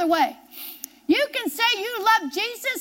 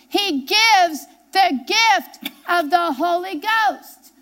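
slow emphasis; 2 semitones higher, 3 dB louder, much slower than usual. Real, not acted. Preacher repeats the point slowly.

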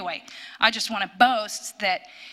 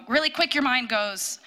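ordinary delivery

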